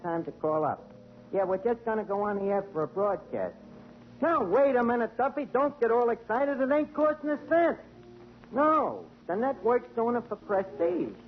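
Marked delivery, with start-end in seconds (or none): none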